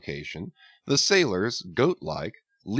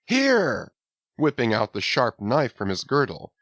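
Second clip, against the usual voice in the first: jolly urging; 7 semitones higher than usual. A narrator's reading. Stubb cheering his crew to pull harder, jolly. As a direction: egg the crew on merrily.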